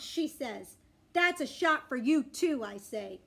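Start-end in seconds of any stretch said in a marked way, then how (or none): 1.1-2.6 slow, louder, higher comeback; defiant retort. Acted. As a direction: pay the doctor back, emphatically. Dog-girl gets back at doctor.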